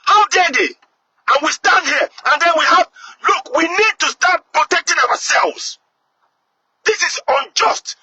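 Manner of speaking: outraged. Real, not acted. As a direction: let the indignation burst out. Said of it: "really pissed right now".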